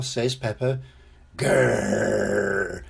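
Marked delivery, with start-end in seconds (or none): 1.4-2.8 low, drawn-out playful growl. A narrator's reading. George answers as his dinosaur, playful growl. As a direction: growl a long low "grrr". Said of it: "replies George".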